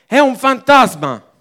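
high, loud startled shout of the mind; frightened cry. Pretend. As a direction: shout it like you just saw a ghost. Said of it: "La nostra mente che cosa ci dice?". (grido spaventato, alto e forte)

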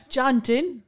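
high north side "Jon-tin"; hammed-up north side Jonathan; north side accent. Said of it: "Not Jonathan".

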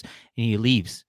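ordinary talk, nothing marked

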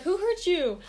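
high-pitched mock parental demand; questioning dad. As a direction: imitate a dad checking on his kid. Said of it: "and then my dad goes".

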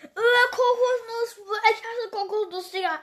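loud disgusted wail; disgusted cry. Real, not acted. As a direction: recoil from a flavour you hate. Tasting hated coconut flavour.

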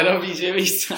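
stammered answer through laughter; laughing while quoting himself. Retold or imitated. Telling the coworker he only shoots a clip, laughing. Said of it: "A já úplně".

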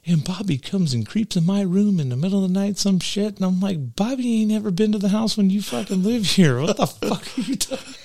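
animated retelling. Retold or imitated. Retold family argument.

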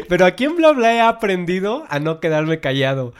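talking through real laughter; laughing. Real, not acted. Podcast host laughing at himself for forgetting what he wanted to say.

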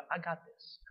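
quiet, understated aside; calm assurance. Retold or imitated. Jesus reassuring people who tell him how to heal, calm assurance.

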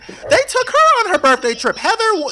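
high, loud exclaiming; worked-up and outraged; indignant outburst. Real, not acted. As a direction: voice shoots up in disbelief. Indignant on others' behalf.

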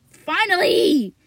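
loud, high, drawn-out finally; escaping the hot closet, a loud relieved shout. Real, not acted. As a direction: cry out in relief. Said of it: "getting out of my hot closet".

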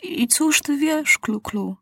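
put-on a boy king moved by loyalty; moved question. A narrator's reading. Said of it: "wzruszony zapytał się Maciuś".

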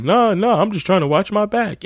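very high whiny excuse; imitating the dude. Dude excuses himself to the girl, very high whine. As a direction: squeak out a cowardly excuse. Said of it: "He's like".

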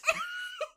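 a big shrieking laugh at her own joke. Real, not acted. Friends recalling the mutant cat joke, burst out laughing.

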